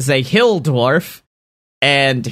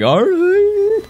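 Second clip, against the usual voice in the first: imitating friend. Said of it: "and you're like".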